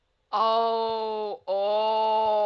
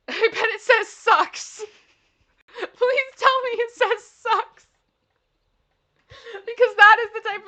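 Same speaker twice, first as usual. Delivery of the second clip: laughing; 12 semitones higher, 10 dB louder than usual. Real, not acted. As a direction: giggle out the guess, delighted. Laughing at the sign guess.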